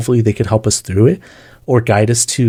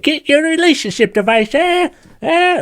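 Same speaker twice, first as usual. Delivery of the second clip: high New York newsboy hawking papers; New York newsboy accent. Acted. A newsboy in overalls selling relationship advice, in a high New York accent. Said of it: "with the New York accent".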